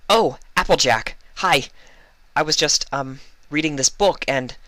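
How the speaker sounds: high, loud, flustered babble; startled Twilight covering nervously; nervous stumbling. A narrator's reading. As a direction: caught off guard, trip over the words. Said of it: "stumbling over her words nervously".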